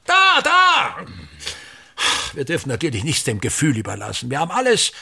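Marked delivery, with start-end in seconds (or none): 0.0-1.0 high, loud barked pointing; impatient shout. Acted. Theatre director shouting at his son during rehearsal, loud and impatient. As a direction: snap at him to look right there.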